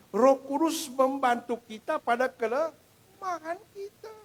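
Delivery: slow, high, loud restating of the verse; deliberate loud sermon refrain; emphatic proclamation. Acted. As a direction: drive the verse home. Preacher restating key verse.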